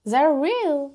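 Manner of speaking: a playful corrected take of Zero Real; put-on English accent. Shown as a demonstration. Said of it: "oh punaise, mon accent".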